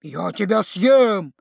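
slow, loud, hammed-up zombie threat; mock zombie howl. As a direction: drag it out like a pretend zombie. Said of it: "патетично и громогласно провыл он". (патетично и громогласно, завывая)

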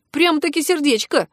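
piqued, teasing curiosity; intrigued. A narrator's reading. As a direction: sound suddenly interested. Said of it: "заинтересовался я".

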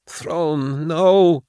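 high, breaking whimper. A narrator's reading. Kara whimpering at Gideon, worn out. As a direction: whimper a weak refusal. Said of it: "she whimpered".

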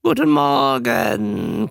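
slow, loud, rasping croak; a tired trained newt greeting the audience. Acted. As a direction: croak it like a pained animal. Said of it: "krächzte das Tier".

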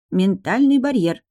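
slow, loud, stress on Ментальный; patient repetition. A narrator's reading. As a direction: spell it out slowly. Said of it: "повторил Трикс".

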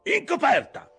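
high, loud shouted command; angry order. A narrator's reading. Ahab orders his officer back on deck, angry shout. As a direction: bellow the dismissal.

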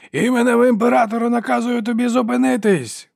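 high, sustained official yell; shouted command. A narrator's reading. Soldier orders fleeing Ferro to stop, shouting. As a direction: order a halt at full voice. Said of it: "вигукнув один із вершників".

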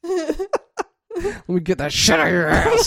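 hammed-up bossy helper, slow and high; high, slow mock caregiver. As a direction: drag it out high and laughing. Role-play caregiver.

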